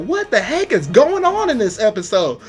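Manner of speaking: high-pitched incredulous outburst; amazed disbelief. Retold or imitated. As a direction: exclaim it, baffled and hyped. Retelling own amazed reaction.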